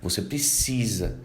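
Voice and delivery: ordinary delivery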